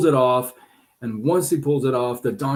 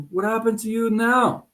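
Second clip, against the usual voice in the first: fox; 11 semitones higher than usual. Acted. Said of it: "back to the donkey and says".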